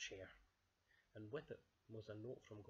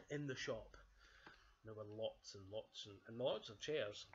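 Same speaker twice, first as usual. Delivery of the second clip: ordinary delivery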